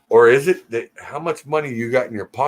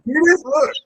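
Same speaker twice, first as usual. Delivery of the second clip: much higher, fast exasperated whine; 13 semitones higher, 4 dB louder, faster than usual. Real, not acted. Venting to viewers about Justin, high fast exasperated whine. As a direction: throw up your hands at the chat, whining.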